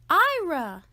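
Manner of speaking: high, loud surprised cry; surprised shout. Acted. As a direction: shout his name in surprise. Whole family surprised at the door.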